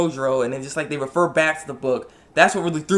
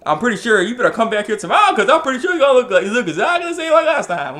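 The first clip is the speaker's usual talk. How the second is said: hammed-up old man; 7 semitones higher, 7 dB louder than usual. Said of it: "And he's like".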